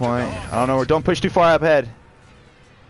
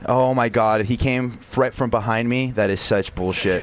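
ordinary talk

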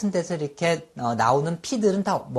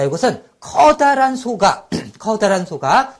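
louder, higher storytelling push; 4 semitones higher, 8 dB louder than usual. Shown as a demonstration. Teacher tells vivid mnemonic.

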